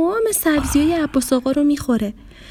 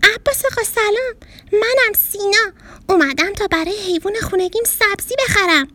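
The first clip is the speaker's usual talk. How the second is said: put-on higher boy's voice calling through the shop door; 9 semitones higher than usual. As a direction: call out cheerfully to the grocer. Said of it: "داد کشید".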